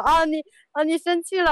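high, tender mock TV-hero voice; drama hero line. Wife mimicking a TV hero chasing the heroine, high. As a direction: play the lovestruck male lead. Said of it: "然后一把拉住他说".

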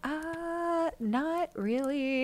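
soft, slow, shaken reply. Retold or imitated. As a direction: answer quietly, still rattled. Shaken friend quoted.

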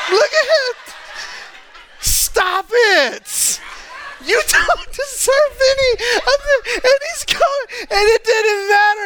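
very high, laugh-cry shouting; laughing hysterically. Real, not acted. To the congregation at the photo, hysterical laughter. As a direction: lose it completely laughing.

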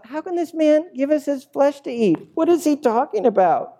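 high-pitched indignant crowd voice; comically outraged objection; sharp arguing. Acted. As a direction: argue it high and incredulous. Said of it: "began to argue sharply amongst themselves".

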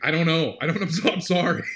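high, loud, laughing sorry; retold friend apologizing, half laughing; flustered apology. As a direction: gush an embarrassed apology through laughter. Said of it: "He's like".

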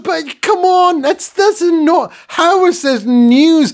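exasperated rant. Real, not acted.

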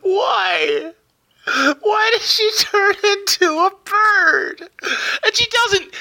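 mock wail. Real, not acted. Co-host wailing at the plot.

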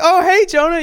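high, loud, delighted hello; excited greeting. Retold or imitated. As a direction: greet him like she's thrilled to see him. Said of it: "she's super excited to see me".

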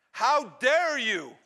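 loud, high, drawn-out outrage; slow, loud indignant rebuke; angry father. Acted. Father disowning his returning son, outraged.

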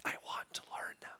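fast, whispered yearning; longing whisper. Retold or imitated. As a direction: breathe the wish in awe. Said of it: "you're like".

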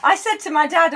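usual speaking voice

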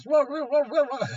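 high, loud "rah-rah" barking imitation; mock dog barking. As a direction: yap like a dog interrupting from the back room. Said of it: "the dog barking in the back of the house".

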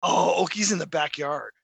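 usual speaking voice

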